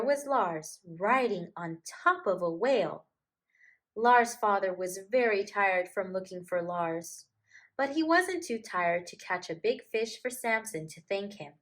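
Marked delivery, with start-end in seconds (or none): none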